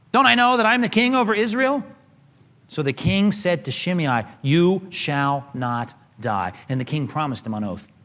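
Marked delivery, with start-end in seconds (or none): 0.1-1.8 higher-pitched rhetorical challenge; acted kingly retort; indignant assertion. Acting David asserting his kingship. 4.4-6.5 very slow, spaced-out words; acted solemn, deliberate verdict; royal pronouncement. Acting the king's pronouncement.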